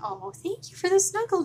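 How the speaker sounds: high, gushing thanks; high touched gush. Real, not acted. Touched by the puppet's praise.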